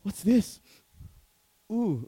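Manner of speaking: high, loud grossed-out cry; mock disgust at smell. Friends reacting to sweaty crowded church, disgusted. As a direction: pull a face at a bad smell. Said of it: "Some of us would go".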